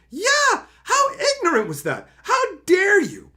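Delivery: high, loud indignant huff; mock outrage. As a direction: play it up as scandalised outrage. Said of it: "walked off thinking".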